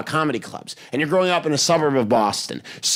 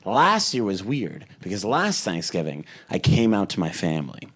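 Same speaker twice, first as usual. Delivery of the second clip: ordinary talk, nothing marked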